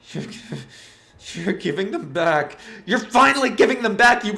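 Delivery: rising to a high, loud furious scream. Acted. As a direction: build from anger to an unhinged shriek. Enraged Wanda crawls toward Dorothy.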